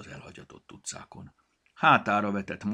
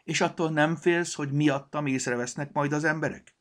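high, loud bunny voice; raised, lively little-creature voice; plush bunny; 8 semitones higher, 7 dB louder than usual. A narrator's reading. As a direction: ask in an animated toy-rabbit voice. Lively plush bunny questions the gnome.

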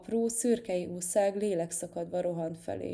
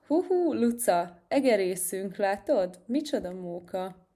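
gleeful whoop; 4 dB louder than usual. Acted. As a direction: whoop like a kid on a ride. Cat gleefully riding with mouse. (hangos, vidám ujjongás)

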